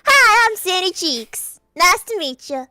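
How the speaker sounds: high, slow Southern cartoon greeting; perky cartoon character with a southern accent; Sandy Cheeks. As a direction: greet them in a big Texan twang. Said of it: "You sound like Sandy Cheeks".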